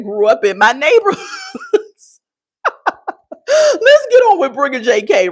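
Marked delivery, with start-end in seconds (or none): 3.5-5.3 high, loud, still laughing. Real, not acted. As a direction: pull yourself together through the laughter. Recovering from a laughing fit.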